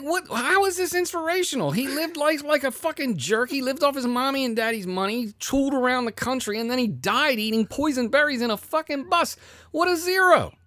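high-pitched, sustained retold tirade; exasperated, worked-up mock rant; angry rant. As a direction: rail in incredulous outrage, voice way up. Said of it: "I was so angry".

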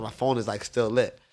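ordinary talk, nothing marked

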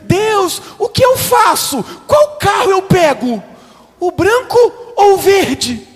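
high, loud, slow whiny plea; mock pleading believer. Pastor mocking believers asking God about cars, high loud whine. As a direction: wail it like a helpless believer. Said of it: "A gente fica".

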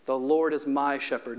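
ordinary delivery

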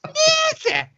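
very high, loud comic outburst; squawked fake spirit voice; mock possession. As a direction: burst out as if suddenly possessed, hammed-up. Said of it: "get into a possession".

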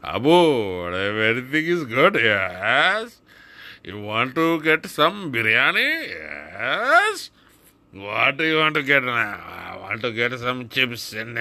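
exaggerated singsong Indian accent; hammed-up, swooping Indian accent; head-shaking Indian accent. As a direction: play both sides in a thick Indian accent. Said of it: "shake your head Indian".

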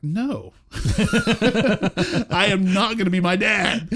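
high, loud protest through laughter; laughing refusal. Retold or imitated. Youth refusing to become his preacher dad, laughing loud protest. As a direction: refuse loudly, laughing at the memory.

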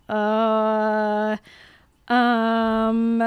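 long, level drawn-out hesitation; awkward stalling. Acted. Friend at the window reluctant to say who it is, stalling. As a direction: drag out each filler, stalling.

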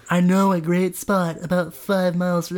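a new relaxed voice for Trent; Trent's new voice. Shown as a demonstration. Trent chatting to Bambula, new relaxed voice. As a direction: try out a fresh easygoing Trent. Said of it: "this is Trent's new voice".

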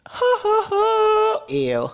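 shrill mocking ha ha ha, then ew; high cackling laugh then disgusted ew.

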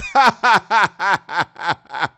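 a big real laugh bursting out loud; hearty laugh. Real, not acted. Host bursts out laughing at his own bit about wives. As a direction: crack up at your own joke.

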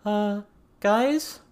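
high, loud uncertain call; Avery calling out. A narrator's reading. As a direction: call out unsure into the house. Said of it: "Avery called out".

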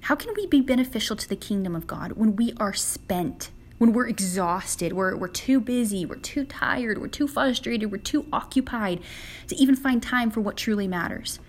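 ordinary delivery

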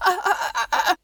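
put-on high, panicked stammer of a young monk; panicked stuttering. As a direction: stammer in fear, barely getting a word out. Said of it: "he stutters over his own words".

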